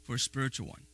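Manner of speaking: spiritual leaned on, dropped soft and low; soft echo. Shown as a demonstration. Preacher repeating the key phrase, softly. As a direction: echo it quietly.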